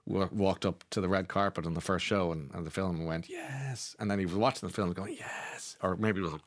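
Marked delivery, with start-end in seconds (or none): 3.3-4.0 hushed, drawn-out mock yes; self-satisfied imitation. Actor watching himself on screen at the premiere, quietly self-satisfied. 5.2-5.8 whispered mock yes; hushed self-congratulation. Actor to himself while watching his film, hushed self-congratulation.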